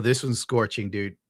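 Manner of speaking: usual speaking voice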